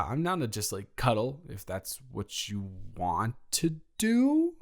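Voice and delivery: "cuddle if that's what you want to do" ending on a rising, unsure lift; like a question. Real, not acted. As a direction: say it as if asking, not stating. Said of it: "I'm saying that like a question".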